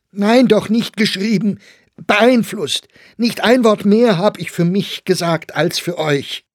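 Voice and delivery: loud, high-pitched, heated correction; angry protest. A narrator's reading. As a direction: defend yourself at shouting volume. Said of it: "Meyer wurde nun auch zornig". (zornig, laut und hoch)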